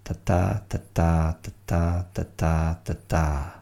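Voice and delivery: "ta ta" with the second beat stressed; chanting iambic feet, da-DUM; iambic rhythm. Shown as a demonstration. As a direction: tap out short-long beats five times. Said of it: "one short, one long".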